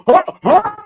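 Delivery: two high pained grunts; imitated pain yelps. Imitating Jason stabbed in the kneecap, high yelps. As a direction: mimic yelps from a stab. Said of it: "Jason gets stabbed in the kneecap".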